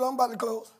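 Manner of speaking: ordinary delivery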